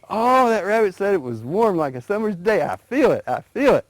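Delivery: building eagerness as the heat rises; excited. A narrator's reading.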